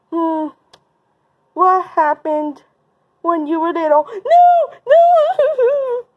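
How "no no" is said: very high, loud mock wail; pretend crying. Bully asked about being little, mock wailing. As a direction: bawl in hammed-up distress. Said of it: "how to make you cry".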